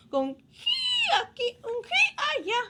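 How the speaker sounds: high, slow whinnying voice; horse neigh. Retold or imitated. Horse neigh sung for child.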